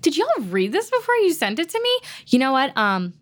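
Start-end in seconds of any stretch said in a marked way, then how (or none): 0.0-2.0 2 stretched-out, baffled question that climbs high at the end; 3 act stunned that anyone sent you this; 1 incredulous disbelief. Retold or imitated. 4 actor reading a bad audition script, baffled and higher.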